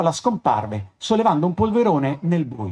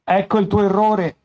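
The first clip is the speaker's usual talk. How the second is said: a touch of irritation, slightly raised; punta di irritazione; 4 semitones higher than usual. A narrator's reading. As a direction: correct him with mild annoyance. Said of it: "con una punta di irritazione nella voce". (con una lieve irritazione)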